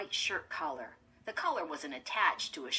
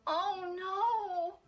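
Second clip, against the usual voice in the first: high, louder cry of horror; horrified cry; 13 semitones higher, 5 dB louder than usual. A narrator's reading. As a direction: cry out aghast. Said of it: "Chris cried aloud".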